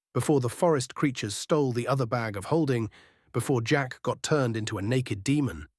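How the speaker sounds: ordinary talk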